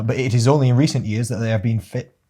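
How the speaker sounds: ordinary talk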